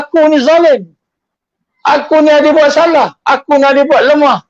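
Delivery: fervent sermon voice confessing sins; impassioned confession. Acted. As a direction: plead your guilt at full force. Preacher voicing Yunus confessing.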